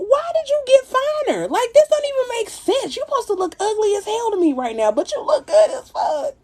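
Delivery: mock indignation. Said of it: "I'm like".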